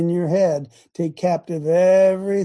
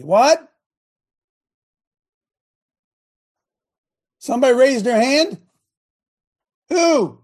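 high, loud shout across the room; yelling at wife; 8 semitones higher, 3 dB louder than usual. Real, not acted. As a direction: holler back, high-pitched and questioning. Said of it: "I yell at my wife".

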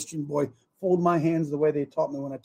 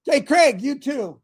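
loud, high call for attention; calling out loudly; 8 semitones higher, 7 dB louder, slower than usual. Real, not acted. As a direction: holler over to a friend. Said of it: "I'm yelling over there".

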